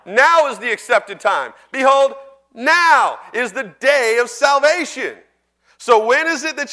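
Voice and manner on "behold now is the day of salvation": slow, loud proclamation with "now" lifted; proclaiming. Real, not acted. Preacher to church, slow loud proclamation. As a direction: declare it slowly and loud to the room.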